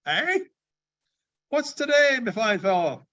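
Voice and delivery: high, bright calling out; eager calling. Acted. Scrooge calling down to the boy, high and eager. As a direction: shout down to the street.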